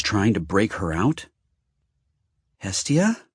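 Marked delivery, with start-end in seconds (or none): 2.6-3.3 calling her name, rising; calling out to Hestia in worry; called out. A narrator's reading. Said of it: "he called to her".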